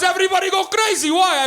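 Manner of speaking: put-on high, loud, accented bewildered question; puzzled Portuguese dad. Dad asking son why all cheer, accented shout. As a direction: ask it over the noise. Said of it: "He goes".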